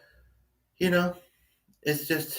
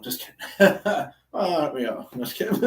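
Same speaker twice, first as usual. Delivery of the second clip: ordinary delivery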